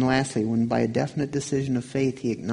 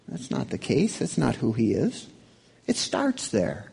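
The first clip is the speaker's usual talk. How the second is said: normal talking voice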